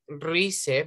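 "rice" read letter by letter as Spanish "REE-seh"; spelling pronunciation. Shown as a demonstration. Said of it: "pero no pronunciamos rice".

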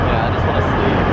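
usual speaking voice

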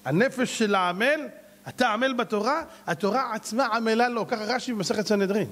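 usual speaking voice

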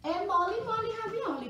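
high-pitched, bouncy encouragement; playful rhyme. Shown as a demonstration. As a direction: chirp a silly catchphrase to children. (bordão agudo e brincalhão)

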